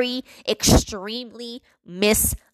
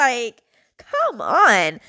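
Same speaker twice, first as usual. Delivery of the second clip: ordinary talk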